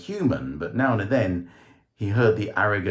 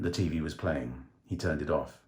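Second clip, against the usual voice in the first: ordinary delivery, nothing marked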